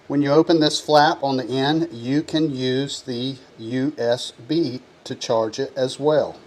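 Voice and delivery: ordinary delivery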